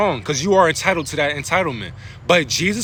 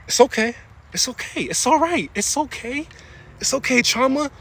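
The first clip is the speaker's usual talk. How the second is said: soothing; 5 semitones higher than usual. Pretend.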